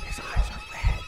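soft, voiceless whisper; whisper, hardly daring to speak; frightened whisper. Real, not acted. Player hiding as his eyes glow red, whispering. Hiding from enemy that hears him.

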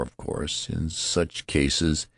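plain reading voice

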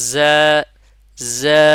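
জা with a buzzing z and held vowel; articulation demo. Shown as a demonstration. Teacher asking where it is articulated, demonstrative. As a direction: say জা loud and drawn out. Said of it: "কোথা থেকে উচ্চারণ করলাম".

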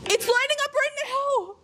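high, thrilled shout; excited. Real, not acted. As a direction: burst out in delight. EMF meter lights up.

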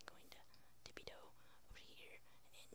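barely audible tiptoe whisper; sneaky whisper. Pretend. Player tiptoeing over to the cow, sneaky whisper. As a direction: whisper it while creeping up.

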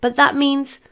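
high-pitched, dawning dismay; worried, unfinished thought; dismayed realisation. A narrator's reading. Dismayed friend realises the catch.

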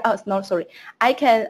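ordinary delivery

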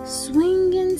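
plain reading voice

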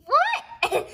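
high, loud squeal through a laugh; laughing what. Real, not acted. Kid laughing at the nonsense rhyme. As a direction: giggle out the disbelief.

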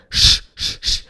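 "sh" as a bare voiceless hush; short separate hushes of "sh"; isolated sh sound. Shown as a demonstration. Teacher isolates the S C H sound, bare "sh". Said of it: "faz som de sh".

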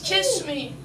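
usual speaking voice

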